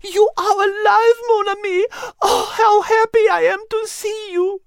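very high, tearful French-tinged delight; overjoyed reunion, close to crying; high teary cry of happiness; joyful Liz. Acted. Liz greets Nelson.

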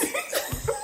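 high, soft giggle; amused laughter. Real, not acted. Laughs at the dig.

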